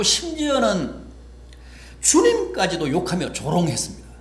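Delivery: loud, high-pitched sermon delivery; fervent preaching. Acted. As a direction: preach it with full force. Preaching at full force.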